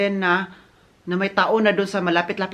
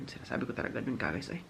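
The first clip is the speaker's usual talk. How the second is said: breathy whisper, much softer; whispered aside; 7 semitones lower, 13 dB quieter than usual. Real, not acted. Vlogger confiding to viewers what she told herself, whispered. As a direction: drop to a secret whisper.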